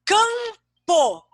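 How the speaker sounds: teacher's dictation of "campo", nasal CAM drawn out; syllable by syllable. Shown as a demonstration. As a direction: sound out "campo" in two held, ringing syllables. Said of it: "presta atenção".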